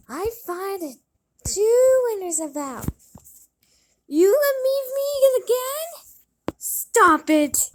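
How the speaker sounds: high and loud, a mean stepsister's screech; shrill shriek. A narrator's reading. Stepsister shrieking at Cinderella to stop. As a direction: cut her off in a loud high yell.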